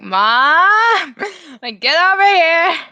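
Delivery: high, loud retold yell for mom; calling for mom. Brother backing away calls mom over, imitated yell.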